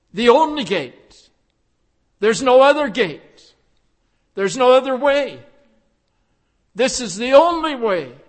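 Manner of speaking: rising preacher's fervour; forceful preaching. Acted. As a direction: preach it out, pounding each claim. Preaching climax on only way.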